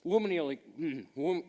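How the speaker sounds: talking with mouth full; words through a bite of food. Real, not acted. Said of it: "Finish chewing".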